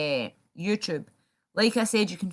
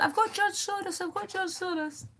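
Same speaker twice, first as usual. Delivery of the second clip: high, put-on voice, mocking and gloating; mocking the online rival; 13 semitones higher than usual. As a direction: parody a rival boasting about his find. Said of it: "he's like".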